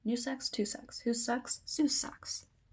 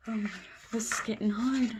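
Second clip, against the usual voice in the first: usual speaking voice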